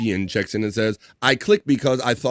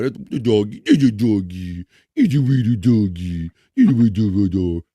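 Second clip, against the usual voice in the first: doggy talk; 3 dB louder, slower than usual. Real, not acted. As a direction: coo at the dog to get its attention, drawn out. Calling to the dog on camera.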